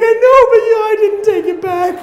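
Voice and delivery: very high, loud squealed impression; wife laughing helplessly. Husband imitating wife defending herself, squealing with laughter. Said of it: "she was laughing so hard".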